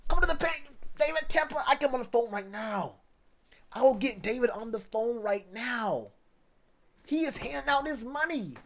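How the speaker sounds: ex-teammate boasting about his Panthers deal, giddy and high; hyped-up buddy. Retold or imitated. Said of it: "This man said".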